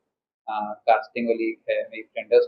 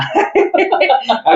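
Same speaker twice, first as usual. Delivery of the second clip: real laugh; 4 dB louder than usual. Real, not acted. Asked if he knows Marathi.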